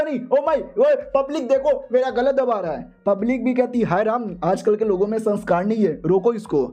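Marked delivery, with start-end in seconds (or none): 0.0-2.9 high-pitched, hammed-up cry for help; mock panic. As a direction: call to the crowd as if being throttled. Acted victim being choked calls for help. (ऊँचे सुर में बनावटी घबराहट भरी गुहार)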